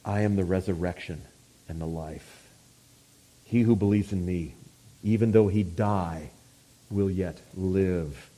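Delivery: low, hushed solemnity; soft and low, with weighty pauses; quiet, reverent pronouncement; tremendous words. Retold or imitated. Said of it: "he gives us those tremendous words".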